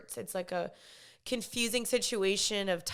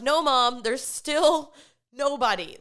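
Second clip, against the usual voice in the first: loud, fed-up reply to a parent; mock exasperation, drawn out and loud; exasperated; 3 semitones higher, 7 dB louder, slower than usual. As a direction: push back at mom's nagging. Exasperated reply to mom's nagging.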